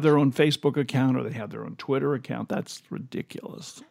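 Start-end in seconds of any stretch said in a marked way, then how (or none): 2.5-3.8 whispered, dismissive; hushed aside. Real, not acted. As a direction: drop to a whisper.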